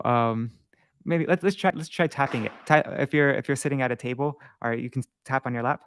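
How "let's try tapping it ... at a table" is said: ordinary talk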